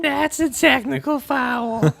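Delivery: high, slow cartoon voice; high-pitched movie character imitation; Whitey. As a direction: draw it out in a squeaky impression. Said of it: "that's from, um, the Adam Sandler movie, Whitey".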